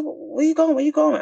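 high, whiny questioning; mock him calling after her; squeaky puzzled boyfriend; suspicious boyfriend. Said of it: "He's like".

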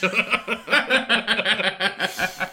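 high burst of giggling; helpless laugh; real laughter. Real, not acted. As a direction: crack up at the punchline. Cracking up at own joke.

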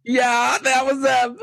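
very high cartoon voice; high squeaky voice. Team Rocket reference.

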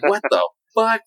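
jolted disbelief at the surprise; shocked outburst. Retold or imitated. Shocked at real peanut brittle.

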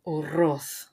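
Uroth said with a rolled double R. Shown as a demonstration. Cohost corrects the surname's pronunciation, rolling the R. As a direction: show how the R in Uroth rolls. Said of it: "I finally can do the double R".

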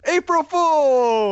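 drawn-out, high, loud holler; big long triumphant shout; prank reveal shout. Real, not acted. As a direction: yell it out gleefully, stretching the words.

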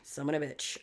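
a quiet grudging repeat; muttered echo. Retold or imitated. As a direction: say it under your breath. Echoing the curse quietly.

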